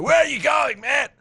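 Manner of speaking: hammed-up shout at a friend sneaking away; drunk shout. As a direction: yell it across the crowd like a drunk mate. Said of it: "And he's like".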